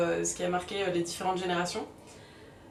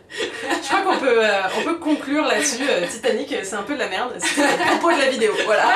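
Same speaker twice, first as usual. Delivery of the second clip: louder, laughing through words; 2 semitones higher, 8 dB louder than usual. Real, not acted. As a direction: giggle while talking. Laughing through closing joke.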